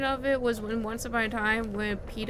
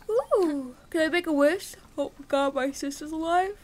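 high, loud delighted squeal; thrilled, bursting with relief; excited; 6 semitones higher, 7 dB louder than usual. Real, not acted. Gamer finding her sister alive, squealing with joy.

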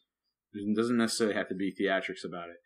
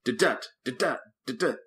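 clipped da-dut syllables, each hit short and sharp; imitating a preacher's staccato hits; staccato beat; 3 semitones higher than usual. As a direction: tap out a staccato preaching rhythm. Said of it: "staccato kinda hit".

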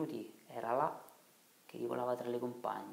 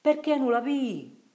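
very high, loud, slowed shout; shouting across water; 12 dB louder, slower than usual. Acted. Marcello shouting to Riccetto far off in the water, loud call. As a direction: throw the question far across the river. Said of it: "gridava Marcello".

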